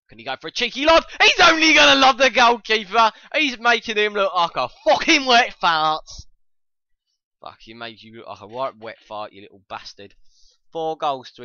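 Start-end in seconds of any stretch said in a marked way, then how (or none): 0.1-6.1 building into a high, loud yell; real gleeful shouted play-by-play; excited commentary. Real, not acted. Live shout as he lobs keeper.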